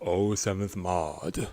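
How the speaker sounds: normal talking voice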